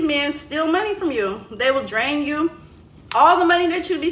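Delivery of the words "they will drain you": ordinary delivery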